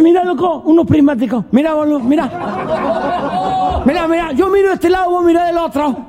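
high-pitched tipsy excitement; excited drunk. Acted. Drunk showing his pal the shotgun as binoculars, high excited voice. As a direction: marvel like a drunk with a new toy. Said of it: "Dos negros venían por medio del campo, se habían chupado".

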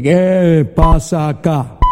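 slow, drawn-out devil voice; Lucifer. Acted. Devil opening hell's door to the visitor, slow demonic voice. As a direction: demand it slowly as a looming demon. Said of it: "Abre la puerta y dice".

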